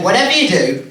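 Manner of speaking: a hint of an anxious organiser laying down the law; promoter's warning. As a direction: warn the acts firmly, dragging out the last words. Acting the promoter's grave warning.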